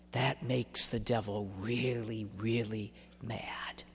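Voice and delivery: hushed, slow secret whisper. Shown as a demonstration. Preacher whispering to the congregation about the devil's anger. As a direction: let it sink to a breathy hush. Quoting saint, dropping to whisper.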